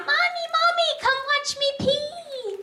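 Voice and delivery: put-on high, drawn-out child's call; screaming little boy. Son calling Mom from the bathroom, childish yell. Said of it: "he's screaming out".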